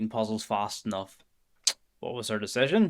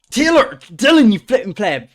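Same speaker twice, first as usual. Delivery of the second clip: screamed names, much higher and much louder; furious shout; 10 semitones higher, 13 dB louder than usual. Real, not acted. Streamer screaming at Dylan for leaking his info, furious shout. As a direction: yell across the room in real outrage.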